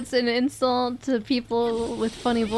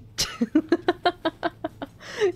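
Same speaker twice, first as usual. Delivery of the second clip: high giggling burst; laughing; 7 semitones higher than usual. Real, not acted. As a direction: keep cracking up at the story. Still amused by the story.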